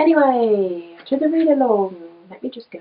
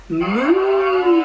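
long higher moo, louder; mooing cow; 9 semitones higher, 7 dB louder than usual. Acted. Parent voicing the cow for her toddler, mooing. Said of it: "And the cow said".

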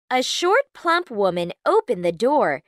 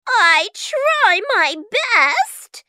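slow, high, sighing witch voice; 11 semitones higher, 4 dB louder, slower than usual. A narrator's reading. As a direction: sigh it out, a bit hurt. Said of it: "she sighed".

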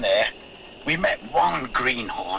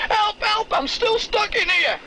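voice calling for help. Retold or imitated. Miner retelling the ventriloquist's trapped voice, crying help. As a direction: shout for help from inside the coal. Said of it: "And the voice is saying".

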